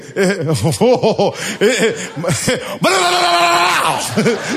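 long rapid-fire babble rising to a shout; machine-gun speech imitation. Shown as a demonstration. Preacher mimics Puerto Rican speech for laughs, machine-gun babble. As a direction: rattle out mock Puerto Rican Spanish like gunfire. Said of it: "It's like a machine gun".

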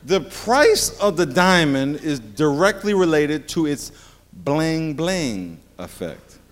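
very slow, leaning on each word; a preacher drawing out his point; slow emphasis. Shown as a demonstration. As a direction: spell it out for the congregation. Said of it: "slow emphatic teaching".

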